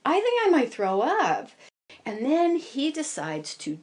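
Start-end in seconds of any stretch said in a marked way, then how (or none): 0.0-1.5 higher, louder, strained alarm; nauseous passenger's silent panic; queasy panic. Retold or imitated. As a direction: dread rising in the throat. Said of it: "and I'm thinking".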